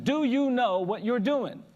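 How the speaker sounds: slow, loud, high-pitched challenge; insistent old man. Retold or imitated. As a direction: draw out each word, pressing for an answer. Old man asks again, insistently.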